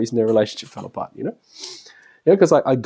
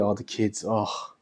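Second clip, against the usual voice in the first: softer, drained moan; worn-out moan; 5 semitones lower, 11 dB quieter, slower than usual.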